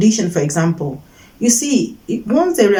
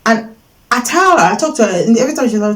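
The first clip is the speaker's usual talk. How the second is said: ordinary talk, nothing marked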